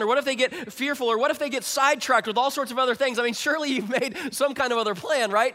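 high, loud, fast worried questions; anxious angel. Retold or imitated. Brave angel pleading with Jesus, high and loud. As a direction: press Jesus with rising worry.

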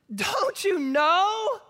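high, drawn-out, incredulous sarcasm; sarcastic. Retold or imitated. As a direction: stretch it out slowly in a high, sarcastic voice. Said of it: "a little bit of sarcasm in his voice".

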